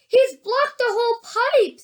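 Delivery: high, excited exclamation; exclaiming. A narrator's reading. Onlooker seeing the pipe blocked, high and excited.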